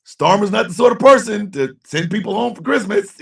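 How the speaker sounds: ordinary delivery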